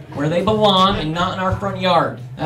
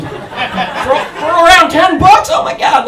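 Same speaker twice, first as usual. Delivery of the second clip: incredulous yell; 10 semitones higher, 9 dB louder, slower than usual. Retold or imitated. Mocking dad waving gun.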